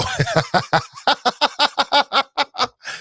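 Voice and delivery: a long high burst of laughter. Real, not acted. Host cracking up at the posts, long high laugh.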